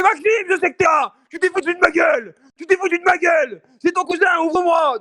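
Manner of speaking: put-on high, loud furious drunken shouting; mock drunk cousin yelling; drunk angry yell. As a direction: scream at the door, offended. Drunk neighbour yelling, retold.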